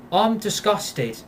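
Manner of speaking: ordinary talk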